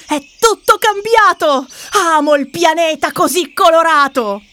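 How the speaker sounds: put-on loud, high, overjoyed child; happy exclaiming. Sister hops happily at the colourful planet, joyful. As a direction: cheer it out with bouncing joy. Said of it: "Saltella felice".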